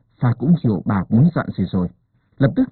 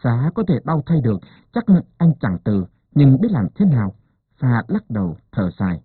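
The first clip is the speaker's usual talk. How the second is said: ordinary delivery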